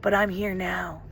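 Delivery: measured meditation guide with long pauses; guided meditation. Real, not acted. Guide asks listeners to greet a hidden part, guided meditation. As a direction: guide them gently inward, pausing to let it land.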